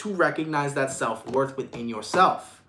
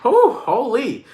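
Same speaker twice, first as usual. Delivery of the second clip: amazed whew; 6 semitones higher, 5 dB louder, much slower than usual. Real, not acted. Reacting to donation.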